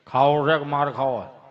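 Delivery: low, slow, loud growl of a threat; grandpa's gruff threat. Retold or imitated. Grandfather scolding the kids: low, slow, loud threat.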